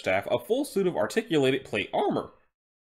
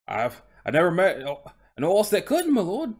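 raised, deferential Cockney horse voice; Cockney coach horse; 5 semitones higher, 4 dB louder than usual. Acted. Horse answering his interviewer, Cockney accent. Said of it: "he's speaking in a Cockney accent".

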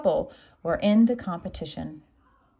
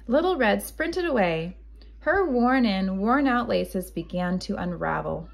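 ordinary delivery

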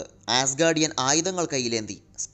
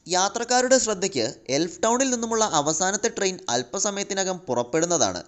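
higher-pitched station announcement; train announcer; 7 semitones higher than usual. A narrator's reading. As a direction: announce a departure to passengers. Mock train announcement in the story. (Railway അറിയിപ്പിന്റെ ഈണത്തിൽ)

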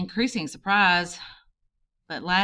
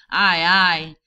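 loud shouted ayes; captain's anguished shout, loud; 7 dB louder than usual. Acted. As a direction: bark it out with full voice. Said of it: "he shouted".

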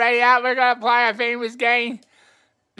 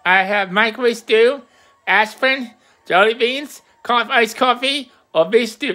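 ordinary talk, nothing marked

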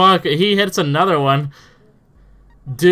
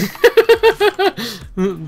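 high laugh; 14 semitones higher, 5 dB louder than usual. Real, not acted. Laughs at opponent's luck.

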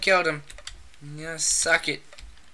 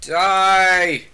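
sharp loud yell, higher; shouted threat; 3 semitones higher, 8 dB louder than usual. Real, not acted. Yelling at an enemy in the game, loud. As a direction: yell it as you fire.

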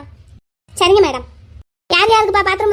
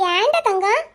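ordinary talk, nothing marked

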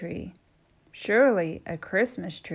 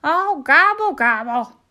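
loud, high groaning gobble; groaning turkey gobble; 7 semitones higher, 10 dB louder than usual. Acted. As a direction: groan in defeat. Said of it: "groaned Turkey".

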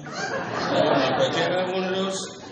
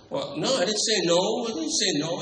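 backpedalling; 6 semitones higher than usual. Acted. Hastily backpedals to partner on phone.

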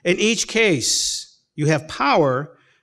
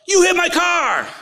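high, shouted mock outrage; angry accuser; 13 semitones higher, 3 dB louder than usual. Young man accuses the preacher of hitting his car, hammed-up angry shout. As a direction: imitate an angry stranger's high yell. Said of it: "And he jumps up".

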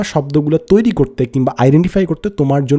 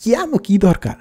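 high-pitched, softer mock whine of protest; exasperated complaint; 8 semitones higher, 3 dB quieter than usual. Recalling hated vocabulary memorisation, high exasperated complaint. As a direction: act out a learner's exasperated questioning. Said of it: "I hated it".

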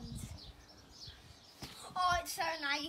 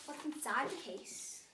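ordinary delivery

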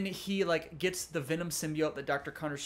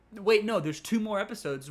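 louder and higher, catching yourself; sudden realization; 5 semitones higher, 7 dB louder than usual. Real, not acted. Reviewer correcting himself to viewers, surprised and louder. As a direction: stop short as if just remembering.

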